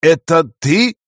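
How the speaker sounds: stunned disbelieving question; astonished. Acted. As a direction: react in sheer amazement. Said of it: "изумился я".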